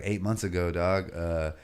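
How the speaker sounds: ordinary talk, nothing marked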